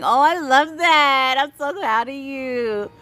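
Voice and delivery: high, loud, slow gush of joy; delighted praise. Real, not acted. Streamer praising a viewer's training, high delighted gush. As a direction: gush over her news.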